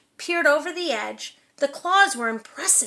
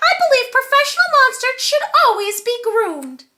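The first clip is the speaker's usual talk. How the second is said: much higher and louder, silky-voiced monster; a dainty monster greeting, pitched way up; 9 semitones higher, 7 dB louder, faster than usual. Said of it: "high, silky voice".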